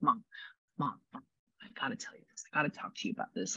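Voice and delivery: hushed, hurried plea to mom; urgent whisper. Retold or imitated. Spirit urging his mother in a rushed whisper. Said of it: "that's the feeling behind it".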